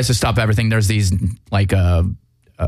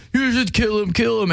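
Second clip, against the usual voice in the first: very high, piping imitation of a kid; mock kid voice; 13 semitones higher than usual. Podcaster voicing the bereaved kid urging Superman, in a high mock kid voice. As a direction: put on a high-pitched child's plea.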